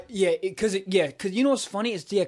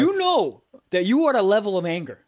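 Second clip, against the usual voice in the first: loud, higher-pitched emphasis; exasperated, emphatic storytelling; worked up; 5 semitones higher, 5 dB louder than usual. Real, not acted. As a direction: raise your voice to drive the point home. Worked up retelling yelling at wind.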